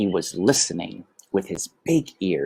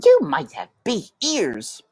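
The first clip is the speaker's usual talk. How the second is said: high, cheeky brother mouse; matter-of-fact big brother; Toulouse; 10 semitones higher, 3 dB louder than usual. A narrator's reading. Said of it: "said Toulouse".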